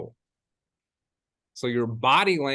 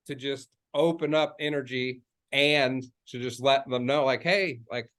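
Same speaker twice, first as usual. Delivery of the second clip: usual speaking voice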